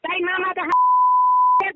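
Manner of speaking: loud, slow, high furious abuse; real furious swearing; angry cursing. Real, not acted. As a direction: spit the curse out slowly and loudly. Abusive caller curses him.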